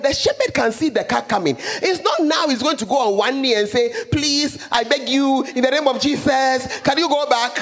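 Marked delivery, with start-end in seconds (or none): 4.1-7.6 mock pleading.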